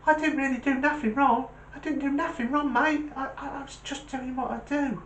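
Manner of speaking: high-pitched, protesting little dog voice; high, stammering terrier; anxious little terrier. Acted. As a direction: plead innocence in a high small-dog voice.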